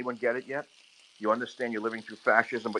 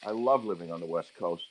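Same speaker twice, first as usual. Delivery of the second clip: usual speaking voice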